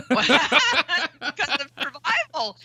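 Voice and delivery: laughing through the words, slow; a real laugh colouring the words, high and slow. Real, not acted. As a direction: chuckle out the point. Laughs while making a point.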